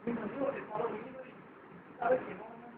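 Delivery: muy bajito para no despertar a nadie; susurro. Acted. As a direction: baja la voz casi a nada. La comadre susurra, marido dormido. (la comadre susurra que su marido duerme)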